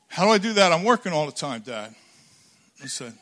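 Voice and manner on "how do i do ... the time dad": louder, higher protest that trails off on Dad; a grown daughter pushing back, frustrated; exasperated daughter. Retold or imitated. Daughter protests to her father that she's always working, exasperated.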